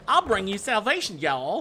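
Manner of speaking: put-on high, loud hammy deep South accent. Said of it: "like, a really deep South accent".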